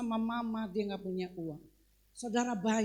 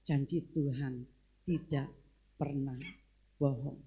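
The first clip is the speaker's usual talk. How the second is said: low "Janji Tuhan... tidak... pernah... bohong", word by word; grave, low-pitched and spaced out; solemn declaration; 8 semitones lower than usual. Retold or imitated. Solemn declaration closing testimony.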